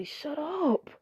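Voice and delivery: hissed, breathy warning to keep quiet; whispered shush to keep the secret; hushed whisper. Retold or imitated. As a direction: whisper it urgently, then slow down. Hushing a secret.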